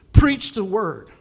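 slow, loud "Preach the word" with weight on "Preach"; emphatic command. Acted. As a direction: bark it like an order. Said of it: "verse two".

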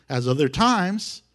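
normal talking voice

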